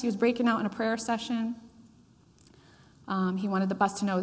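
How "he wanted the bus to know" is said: ordinary talk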